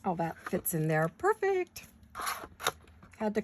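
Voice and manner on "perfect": high delighted "perfect"; pleased. Real, not acted. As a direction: squeal it with delight. Piece fits, pleased.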